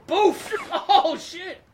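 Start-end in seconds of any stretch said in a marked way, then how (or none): 0.1-0.5 high loud mock boof; impact sound effect. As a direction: shout the hit like a cartoon. Impact sound while watching.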